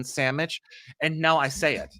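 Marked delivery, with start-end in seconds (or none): 0.0-0.6 sandwich said as "sammich"; a playful "sammich" for sandwich; Southern sandwich. Shown as a demonstration. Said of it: "I always said it for fun".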